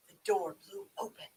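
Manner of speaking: ordinary delivery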